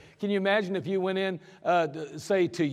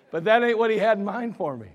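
normal talking voice

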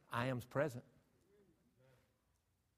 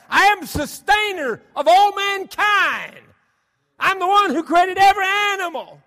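much higher and louder, slow and proclaiming; booming voice of God; 18 dB louder than usual. Acted. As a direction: thunder it out as God Almighty. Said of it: "God said".

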